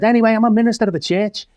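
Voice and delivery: high, fast announcement of a surprise; spring the surprise on the crowd, quick and high; excited reveal. Retold or imitated. Shock reveal to hostile pub crowd.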